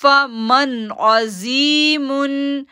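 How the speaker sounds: फमन अजीमुन slowly chanted with a nasal hum on the n; slow Quran recitation; Quranic recitation. Shown as a demonstration. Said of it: "हम पढ़ते".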